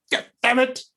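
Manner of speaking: high squeaky mock curse, way up in pitch; imitating father's curse. Gentle father dropping a spoon in the kitchen, high squealed mock curse. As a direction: squeal the curse like a startled dad. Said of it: "you hear him in the kitchen like".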